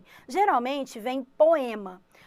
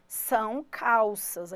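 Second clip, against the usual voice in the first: trousers são calças drawn out very slowly; deliberate slow model; slow teacher emphasis; 3 dB louder, much slower than usual. Shown as a demonstration. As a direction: stretch each word for the class. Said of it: "Olha, agora".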